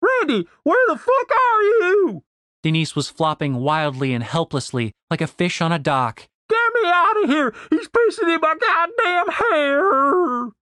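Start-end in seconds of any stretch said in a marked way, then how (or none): 0.0-2.2 very high, drawn-out shriek; frantic high-pitched holler; panicked scream. Acted. Trapped Denise screams for help. 6.5-10.5 shrieking in disgust, very high and drawn out; long high-pitched wail; panicked scream. Acted. Denise trapped, screaming in disgust.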